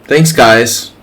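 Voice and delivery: usual speaking voice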